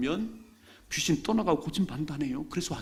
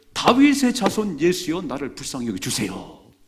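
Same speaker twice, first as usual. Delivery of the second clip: crying out; 8 dB louder than usual. Acted. Blind man crying out to Jesus, loud plea. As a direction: cry out desperately for mercy. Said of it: "막 소리를 지르는데".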